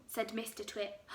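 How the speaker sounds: ordinary delivery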